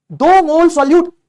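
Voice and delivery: "दो मोल solute" loud, high and stretched out; emphatic definition. Shown as a demonstration. Teacher drumming a definition into students, "दो मोल solute" loud and drawn out. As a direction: call out "दो मोल solute" slowly at high pitch.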